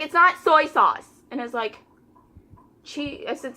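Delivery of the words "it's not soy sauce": a slower, louder mock of the irritated customer, stressing the word not; an impatient customer set straight; irritated correction. Aggravated customer correcting again.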